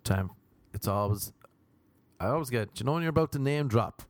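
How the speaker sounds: normal talking voice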